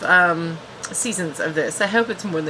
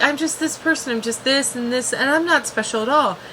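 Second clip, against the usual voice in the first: higher, lightly whiny imitation; mock self-pity; 5 semitones higher than usual. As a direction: put on a girl's self-deprecating whine. Voicing Elora's self-pity.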